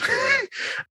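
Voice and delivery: a bright giggling burst; delighted shriek of a laugh; high burst of laughter. Real, not acted.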